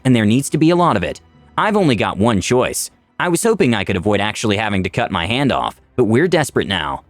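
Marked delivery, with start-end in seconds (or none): none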